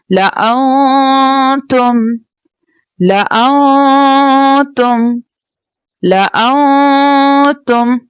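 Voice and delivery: ला आऊं तुम drawn out slowly, syllable by syllable; slow Quran recitation. Shown as a demonstration. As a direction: recite each syllable slowly with long vowels. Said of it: "Quran recitation demo".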